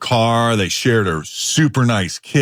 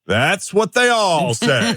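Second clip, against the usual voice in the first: slow, higher teasing disbelief; skeptical tease; 9 semitones higher, much slower than usual. Real, not acted. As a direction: drawl it out like you don't buy it.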